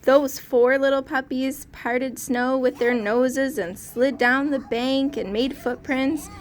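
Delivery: ordinary delivery, nothing marked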